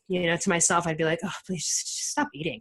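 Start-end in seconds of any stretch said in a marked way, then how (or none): 1.2-2.6 breathy, unvoiced muttered judgment; a scornful aside under the breath; judgy inner whisper. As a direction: whisper it like a snide private thought. Judging obese strangers in her head.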